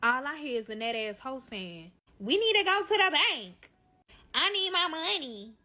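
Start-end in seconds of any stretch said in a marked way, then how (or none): 2.2-5.5 high, mock-demanding voice; insistent demand. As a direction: mimic her demanding it in a raised pitch. Acting out woman demanding money.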